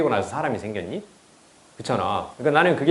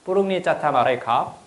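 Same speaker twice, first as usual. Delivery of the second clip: higher-pitched Thai phrase, rising at the end; imitated Thai; 4 semitones higher than usual. Shown as a demonstration. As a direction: mimic a Thai speaker with odd sing-song tones. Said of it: "되게 이상하게 들리죠".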